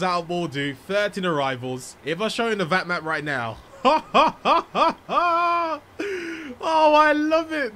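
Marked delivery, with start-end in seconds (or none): 3.6-6.5 high, loud burst of laughter; big ringing ha-ha-ha; delighted laugh. Real, not acted. Delighted by crowded map. 6.6-7.7 high, loud, drawn-out delight; overjoyed, high-pitched and loud. Real, not acted. Delighted by crowded map.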